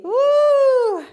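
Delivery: high, loud whoop; excited cheer. Real, not acted. Instructor cheering her Zoom class, loud high whoop.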